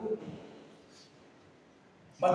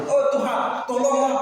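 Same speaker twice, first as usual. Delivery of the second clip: high, loud acted plea; hammed-up desperate prayer from a cliff; pleading cry; 5 semitones higher, 5 dB louder than usual. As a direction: cry up to heaven for help. Cliff man begs God for help.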